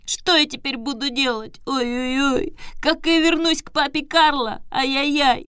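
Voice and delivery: put-on high whiny puppet boy; whimpering. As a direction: whine and snivel pitifully. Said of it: "хныкал так жалобно".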